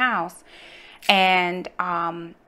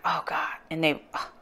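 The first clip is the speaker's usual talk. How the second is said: breathy, exasperated whisper under her breath; a whispered groan of frustration; dismayed whisper; 3 semitones lower, 7 dB quieter than usual. Retold or imitated. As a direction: sigh it out in a soft whisper. Dismay over slipping glasses.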